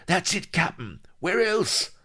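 very high, eager crewman's voice; high, excitable underling; excited pirate sidekick. A narrator's reading. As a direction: pipe up triumphantly to the captain. Vladimir excited at guess.

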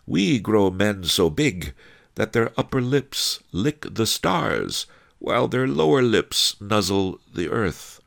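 sweetly measured, high character voice. A narrator's reading. Master Li outdoing the thug's boast, sweetly. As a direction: top his boast with high, honeyed politeness. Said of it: "Master Li said sweetly".

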